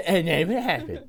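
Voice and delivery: laughing echo of the question. Real, not acted. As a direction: repeat it while giggling. Echoing friend, laughing.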